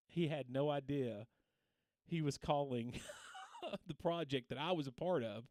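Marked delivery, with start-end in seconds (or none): 3.0-3.8 brief high-pitched laugh; a soft, high giggle mid-sentence. Real, not acted. Streamer amused by his student's unwitting phone call, laughing.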